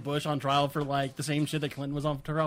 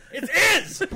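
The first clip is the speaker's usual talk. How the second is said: furious high yell; freaking-out yell; 12 semitones higher, 4 dB louder than usual. Retold or imitated. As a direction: explode in disbelief at the word. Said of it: "He freaked the fuck out".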